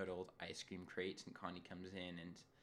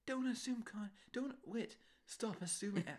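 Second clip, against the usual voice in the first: high, exasperated scolding; frustrated listener plea; 12 semitones higher than usual. Acted. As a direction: plead with them, pitched way up. Listener pleading with the characters.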